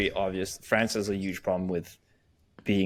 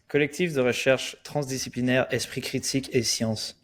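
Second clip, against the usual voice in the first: native French pronunciation, higher pitch; French accent; 4 semitones higher than usual. Shown as a demonstration. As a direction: say it like a proud Parisian. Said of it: "I'll even do the French accent".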